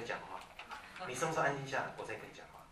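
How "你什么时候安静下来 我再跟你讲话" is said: usual speaking voice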